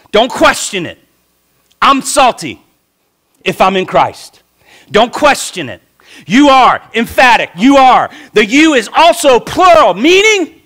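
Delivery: high, loud emphatic preaching, YOU hammered each time; preaching at full force. Acted. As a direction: drive it home, don't let them doubt it.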